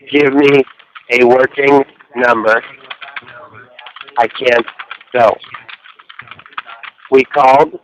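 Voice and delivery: low and measured, long pauses between words; slow, word by word. Shown as a demonstration. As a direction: dictate slowly to the relay operator. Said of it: "please speak slowly".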